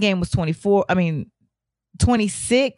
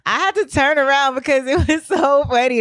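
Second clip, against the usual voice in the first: laughing; 4 semitones higher than usual. Real, not acted. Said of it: "I get a little tickle to laugh".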